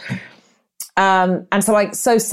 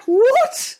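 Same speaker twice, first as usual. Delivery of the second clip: high shrieked disbelief; shocked what. Retold or imitated. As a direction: squeal it in outraged disbelief.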